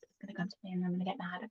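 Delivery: fast whisper, no voice; whispering to herself. Real, not acted. Whispering to herself, still trying to remember. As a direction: rush it out under your breath.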